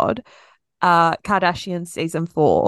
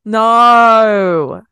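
loud, drawn-out no; emphatic refusal; 8 dB louder than usual. Real, not acted. Co-host rejecting the merch idea, emphatic refusal. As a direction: shoot the idea down loudly, stretching the vowel.

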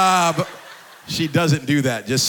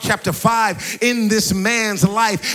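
impassioned preaching; 5 semitones higher, 4 dB louder than usual. Real, not acted. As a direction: preach it with rising fervour, higher and louder towards the end. Preacher builds to full force.

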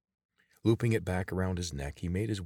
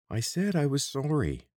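high, loud pleading guard; plead, stung and defensive; whining apology; 9 semitones higher, 4 dB louder than usual. A narrator's reading.

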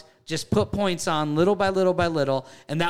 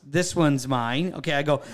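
ordinary talk

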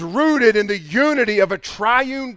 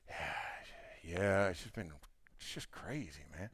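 much softer, low sagging sighs; mopey complaining; 13 semitones lower, 20 dB quieter than usual. Retold or imitated. Gloomy relative telling how his week went, mopey sigh. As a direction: sound worn out by nothing.

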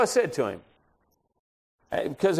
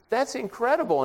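mildly amazed, pitched high; 8 semitones higher than usual. Retold or imitated. Preacher learns salesman attended his university, mildly amazed. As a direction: retell the astonishment with a high lift. Reacts to shared university link.

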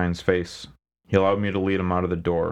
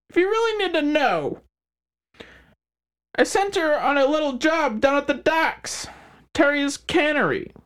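smug crime boss. Acted. McHines voiced character.

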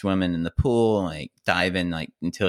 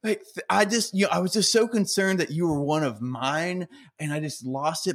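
high, sheepish apologizing coach; apologetic coach; 9 semitones higher than usual. Retold or imitated. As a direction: play the embarrassed coach backpedaling. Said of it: "apologizing to me".

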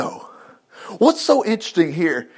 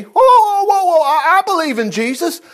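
much higher and louder, whoa whoa; mock protest; 11 semitones higher, 4 dB louder than usual. Preacher mimicking a believer dodging the Lord's point, loud mock whoa. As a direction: throw up a startled mock protest. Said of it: "preacher cries out in mock protest".

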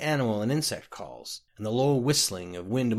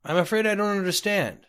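lightly voiced, higher-pitched polite traveler; reply politely in a higher voice; puzzled traveler; 8 semitones higher, 3 dB louder than usual. A narrator's reading. Lost clerk replies to an unseen voice, politely puzzled.

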